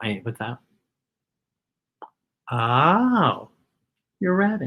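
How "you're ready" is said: usual speaking voice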